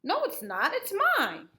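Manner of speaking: put-on high indignant little girl; startled denial. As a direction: insist it's yours, startled. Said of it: "Ruthie was startled".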